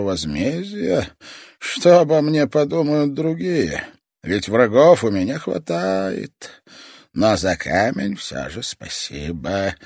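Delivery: put-on high, slow, sinister old toymaker; a menacing old man savouring every word; creepy old man voice. Old toy master speaks.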